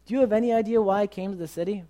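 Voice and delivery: high, loud sudden question; out-of-the-blue question. Retold or imitated. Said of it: "turns to him out of the blue".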